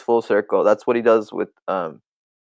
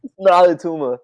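Arnold impression; 4 semitones higher than usual. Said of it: "the Arnold thing".

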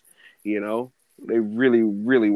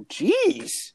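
amazed jeez. Retold or imitated. Amazed reaction at coworker's speed.

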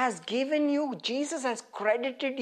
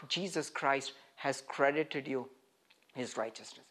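softly; 9 semitones lower, 8 dB quieter than usual. Real, not acted. Tender point about credited righteousness.

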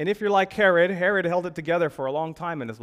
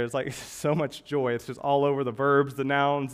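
ordinary talk, nothing marked

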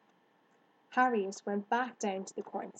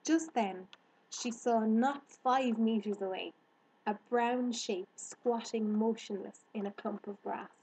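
plain reading voice

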